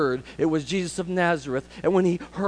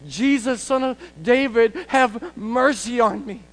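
higher, drawn-out cry for mercy; acted desperate plea; 7 semitones higher, 4 dB louder, slower than usual. Beggar with no way forward calling Jesus, high desperate plea. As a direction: call out high, stretching the final plea.

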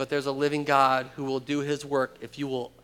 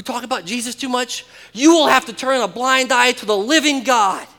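much louder and higher, fervent preaching; full-force preaching; 8 semitones higher, 9 dB louder than usual. Acted. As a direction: thunder it at the congregation.

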